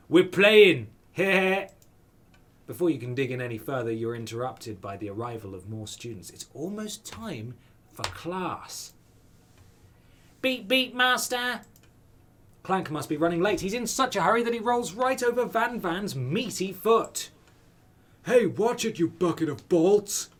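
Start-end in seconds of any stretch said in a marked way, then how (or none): 0.1-1.7 loud, smug and higher; loud sneering laugh. Acted. Character boasting, loud. 10.4-11.6 high beeping robot servant. Acted. Robot character Clank. 18.2-20.3 annoyed. Acted. Van Van insults robot.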